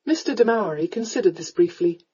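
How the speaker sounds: plain reading voice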